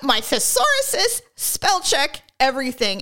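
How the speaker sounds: mock proud.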